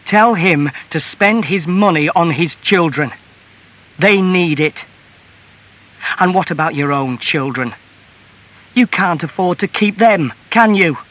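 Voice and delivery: wife's biting challenge; angry reproach. A narrator's reading. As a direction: berate him about the money. Wife taunts husband about money.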